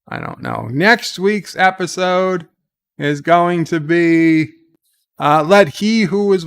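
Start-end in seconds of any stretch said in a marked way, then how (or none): 0.1-0.7 "I don't know" dropped very low and much softer; very deep drop. Shown as a demonstration. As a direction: sink "I don't know" into a low, quiet rumble.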